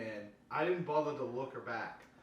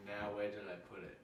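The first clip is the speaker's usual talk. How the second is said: soft, muttered villain line; a hint of a puzzled brute; muttering dark figure; 4 semitones lower, 7 dB quieter than usual. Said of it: "Your dark figure, say".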